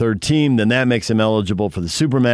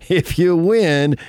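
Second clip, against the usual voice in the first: "If you win" very slow, pitched higher and stressed; building emphasis; 8 semitones higher, much slower than usual. Real, not acted. Co-host hitting the mantra a final time for his partner, "If you win" higher and slower. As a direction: climax of the repetition, stretch "If you win" high and slow.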